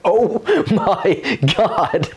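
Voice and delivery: slow, high, laughing shock; drawn-out incredulous laugh-talk; laughing disbelief. Retold or imitated. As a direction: stretch it out high through the laughter. Laughing reaction to torture threat.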